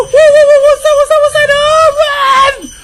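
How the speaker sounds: high, loud panicked stammer; scared horror game streamer. Real, not acted. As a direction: stammer in fright. Spots something scary.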